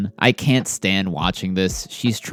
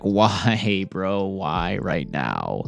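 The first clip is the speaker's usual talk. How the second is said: slow, fading, dismayed plea, much softer; imitating a deflated why; dismayed quote; 4 dB quieter, much slower than usual. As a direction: let it sink and drag out. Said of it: "She's like".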